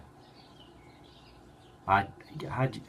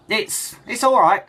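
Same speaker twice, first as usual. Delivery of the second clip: much higher, louder, slow strained reassurance; strained cover-up; 9 dB louder, slower than usual. Retold or imitated. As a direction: push the words out while covering tears. Son covering bad news.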